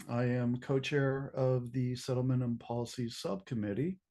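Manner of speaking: usual speaking voice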